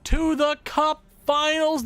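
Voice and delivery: loud, high victory yell; jubilant shout. Real, not acted. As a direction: celebrate at the top of your voice. Team clinches series win.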